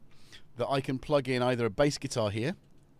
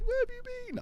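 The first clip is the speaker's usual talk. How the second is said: much higher squeaky cat voice; voicing the cat; much faster than usual. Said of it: "He was like".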